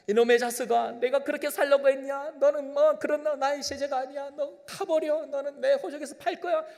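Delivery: high, loud, hammed-up rebuke; mock scolding. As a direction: scold like an offended father, half laughing. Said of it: "하지 않으시고". (과장된 꾸짖음)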